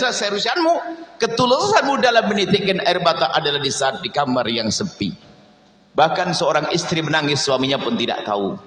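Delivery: ordinary talk, nothing marked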